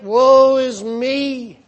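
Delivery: slow, loud anguished cry; cried out. Acted. Preacher voicing Isaiah's slow cry of woe. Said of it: "He cried out".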